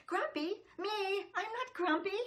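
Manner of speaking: loud, high indignant protest; cross denial shouted high; grumpy monkey. Acted. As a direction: huff it like an offended little monkey. Jim's refrain, grumpy monkey.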